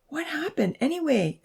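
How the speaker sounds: high female character voice; high-pitched girl's question; Abby, worried girl. A narrator's reading. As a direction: ask in a high, concerned voice.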